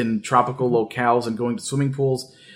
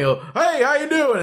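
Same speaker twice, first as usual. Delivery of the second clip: high, eager Southern greeting; friendly Southern dad; 12 semitones higher than usual. Retold or imitated. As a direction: play the overfriendly neighbor dad. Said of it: "he was just like".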